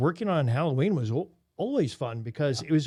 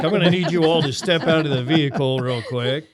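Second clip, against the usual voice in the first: louder stern mock officer order; mock traffic cop; 7 dB louder than usual. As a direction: play the cop ordering them out of the car, half joking.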